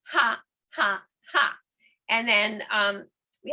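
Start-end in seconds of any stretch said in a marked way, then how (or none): 0.1-1.6 short forced ha breaths; rhythmic yoga breath chant; breath of fire. Shown as a demonstration. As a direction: push each ha out from the belly. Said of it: "So if you try that".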